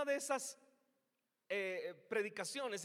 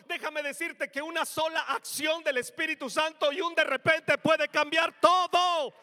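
preaching at full force; 4 semitones higher, 13 dB louder than usual. Real, not acted. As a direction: build it into a thundering declaration. Preaching climax on Spirit's power.

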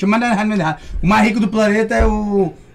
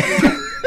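high laugh. Real, not acted.

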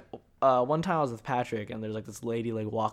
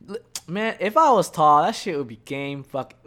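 excited outburst; 4 semitones higher, 9 dB louder than usual. Real, not acted. Hyped about being tall.

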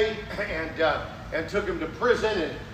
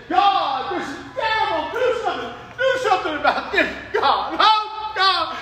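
loud, high cry to God; hammed-up anguished plea; desperate prophet; 11 semitones higher, 7 dB louder than usual. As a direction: beg God to act now. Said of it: "so Habakkuk said".

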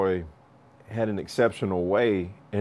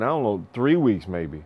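ordinary talk, nothing marked

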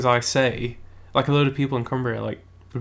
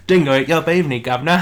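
louder, put-on cockney accent, laughing on governor; mock cockney; 4 semitones higher, 8 dB louder than usual. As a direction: play it as a cheeky cockney. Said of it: "a weird cockney came in through there".

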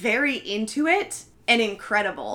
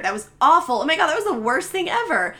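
higher, louder mock disgust; bad-mouthing; 6 semitones higher, 5 dB louder, faster than usual. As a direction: imitate casting directors trashing a film. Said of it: "start bad-mouthing that movie".

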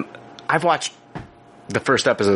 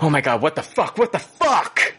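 shocked outburst; 5 semitones higher than usual. Retold or imitated. Retold self-reaction to near crash.

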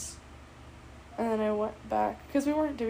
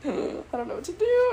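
panicky cry; 8 semitones higher, 5 dB louder than usual. Retold or imitated. Panicked retelling of freaking out.

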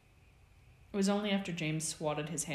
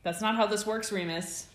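loud, higher, smug lecture; preening; 5 semitones higher, 6 dB louder than usual. A narrator's reading. James ready to pounce on Remus, preening. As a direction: gloat over him, pleased with yourself. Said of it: "James preened".

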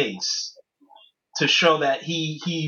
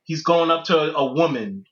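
ordinary talk, nothing marked

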